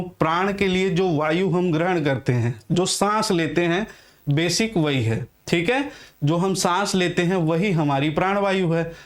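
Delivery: ordinary delivery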